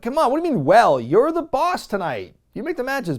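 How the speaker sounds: exasperated protest. Retold or imitated. Jericho protesting to Sharpton.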